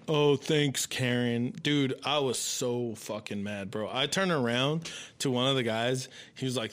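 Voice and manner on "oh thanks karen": ordinary talk, nothing marked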